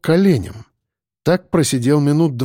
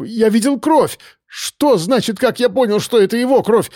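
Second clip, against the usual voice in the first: frantic, voice pitched much higher, on the edge of shouting; a man in panic yelling into the phone; panicked, high-pitched; 8 semitones higher than usual. A narrator's reading. (испуганный крик на высоких нотах)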